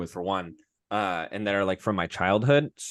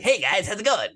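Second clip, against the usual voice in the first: much higher, leering cartoon greeting; Quagmire; 10 semitones higher, 3 dB louder than usual. A cartoon neighbour barging in on his friends, high and leering. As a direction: pop in with a sleazy hello. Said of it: "Quagmire comes in and is like".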